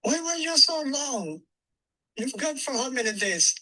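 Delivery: a hint of the mother's puzzled question; mother asking why he was gone so long; Alice asking. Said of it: "she said".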